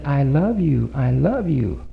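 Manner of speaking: soft, low, tender; tender loving tone. Shown as a demonstration. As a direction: murmur it lovingly, stress on love. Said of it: "The tone of voice says".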